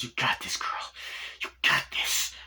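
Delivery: acted whisper of self-encouragement; whispered pep talk. Girl on cocaine steeling herself, whispered pep talk. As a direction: psych yourself up under the breath.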